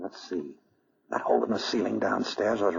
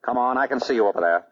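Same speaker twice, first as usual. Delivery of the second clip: loud threatening call into the dark; 4 semitones higher, 6 dB louder than usual. Acted. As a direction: flush him out, voice raised. Calling out in dark cellar.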